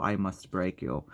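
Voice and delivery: mock Russian accent, deliberately bad; horrible Russian accent. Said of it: "I do a horrible, uh, Russian accent".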